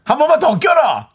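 high-pitched mock warning; acted angry threat. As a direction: threaten him in comic outrage. Acted threat to clueless boyfriend.

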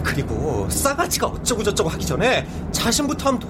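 heated, high-pitched argument; angry shouting. Acted. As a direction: snap back in anger. Acted quarrel in story.

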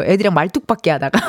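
ordinary talk

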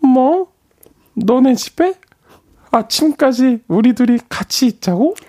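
put-on high, shocked girl voice; girl's voice. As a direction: gasp it out like a startled girl. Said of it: "여자 목소리를 낼 때".

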